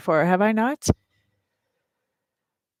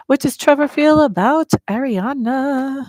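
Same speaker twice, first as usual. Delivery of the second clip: ritual question; 6 semitones higher, 6 dB louder, slower than usual. Real, not acted. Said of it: "asks the cards the question".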